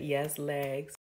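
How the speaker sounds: ordinary delivery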